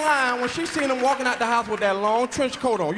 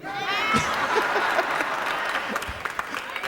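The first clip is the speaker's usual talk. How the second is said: breathy high laugh; unvoiced high laugh; 12 semitones higher than usual. Real, not acted. Comic laughing at his own joint punchline, airy and high. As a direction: crack up at the punchline.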